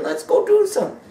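put-on excited parent calling the kid out to play; eager parent. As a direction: pull the child along with excitement. Parent urging child.

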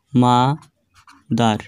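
মা-দার very slow, long মা, pause, then দার; teacher sounding out মা-দার syllable by syllable; split into two syllables. Shown as a demonstration. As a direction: break মা-দার into two clear chunks. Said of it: "দুইবারে উচ্চারণ হলো".

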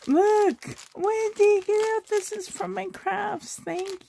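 real thrilled surprise at the gift; overjoyed squeal. Real, not acted. Overjoyed opening gifts from Wendy.

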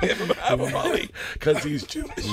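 barely getting through the line for laughing; cracked-up retelling of the punchline. Retold or imitated. Repeats punchline while laughing.

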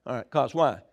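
ordinary talk

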